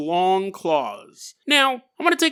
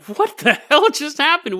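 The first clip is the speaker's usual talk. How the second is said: high-pitched mock astonishment; indignant disbelief; 9 semitones higher, 4 dB louder than usual. Storyteller voicing the hermit's dogs left without any tail, indignant disbelief. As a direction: blurt it out, higher and baffled. Dogs' outraged reaction in story.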